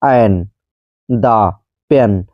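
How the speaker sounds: एन, दा, पेन each as one falling syllable; isolated एन, दा, पेन with pauses between; one breath each. Shown as a demonstration. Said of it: "एक ही सांस में इन तीन, तीनों word का उच्चारण".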